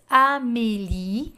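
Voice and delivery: Amélie said slowly with a clear é; teacher modelling French; model pronunciation. Shown as a demonstration. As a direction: stretch out Amélie, open é sound. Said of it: "então tem o so".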